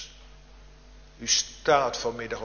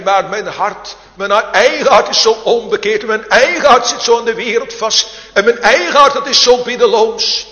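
higher and louder, building to a ringing call; fervent preaching; 8 semitones higher, 12 dB louder than usual. Acted. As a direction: preach it out, pleading and urgent, climbing in force. Sermon rising to full force.

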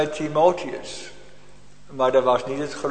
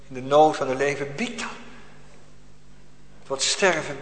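impassioned preaching, swelling and ebbing; fervent sermon; 3 semitones higher, 4 dB louder than usual. Acted. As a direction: preach it with urgency. Preaching with rising force. (vurige preek)

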